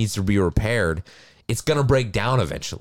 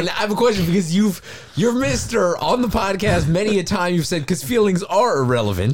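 playful mocking lilt, pitched up; teasing high voice; 9 semitones higher than usual. Teasing her co-host about feelings, high and playful. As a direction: poke fun at him, voice raised.

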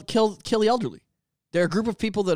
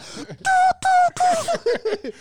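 shrieking giggle; a helpless high-pitched cackle; laughing falsetto squeal. Real, not acted. Big laugh at the sound.

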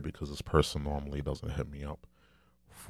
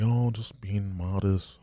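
mock modesty; 5 semitones higher, slower than usual. Mocking a wealthy man's modesty.

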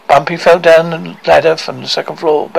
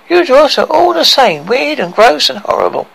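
tearful; 7 semitones higher than usual. A narrator's reading. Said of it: "broke into open sobs".

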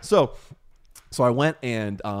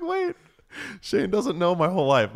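mock shock; 8 semitones higher than usual. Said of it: "I was like".

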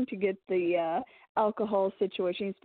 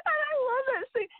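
high squealing delight; oh my god, I love it; thrilled gushing. Real, not acted. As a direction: gush about the scene like a fan. Gushing about favorite scene.

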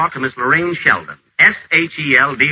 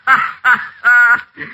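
high loud cackling laugh; gleeful villain laugh; 10 semitones higher, 4 dB louder than usual. Acted. Alone after the scheme, cackling with wicked glee.

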